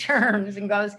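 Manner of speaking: ordinary delivery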